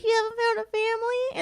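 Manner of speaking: put-on high squeaky kid voice; imitating a tiny squeaky voice; little girl voice. Aunt imitating her niece's squeaky little voice. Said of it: "that little itsy bitsy voice".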